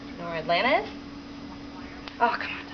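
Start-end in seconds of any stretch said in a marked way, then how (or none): none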